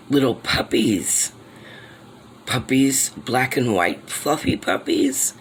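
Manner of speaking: rising delight at cute puppies. Real, not acted. Finding puppies in the wall.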